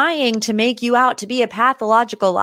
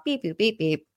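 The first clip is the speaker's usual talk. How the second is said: robot beeps; 7 dB quieter than usual. Retold or imitated. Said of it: "Robot Rachel is powering down".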